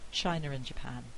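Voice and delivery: "China and Japan" run together as "China-r-and Japan"; intrusive R. Shown as a demonstration. Lecturer repeating the example, intrusive R linking. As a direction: join "China" to "and" with an inserted R. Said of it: "but I've put it in".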